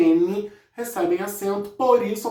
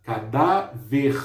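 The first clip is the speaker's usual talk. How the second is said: cadáver very slow with a rising end; slow demo word; 6 semitones lower, much slower than usual. Shown as a demonstration. Teacher slows down to show where cadáver stresses, careful. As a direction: stretch cadáver out and lift the last syllable. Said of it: "Por que que o dá é acentuado?".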